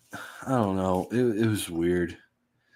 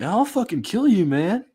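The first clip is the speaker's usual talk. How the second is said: much higher, a hammed-up furious outburst; mock angry threat; 13 semitones higher than usual. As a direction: snap back like you took it personally. Quoting offended Logan's threat.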